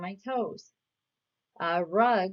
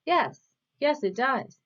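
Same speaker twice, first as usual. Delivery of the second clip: ordinary talk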